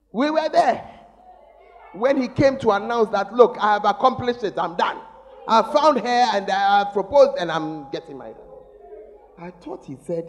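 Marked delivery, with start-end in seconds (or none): 3.3-8.4 loud, proud son announcing his news; excited announcement. Retold or imitated. Son announcing engagement, acted.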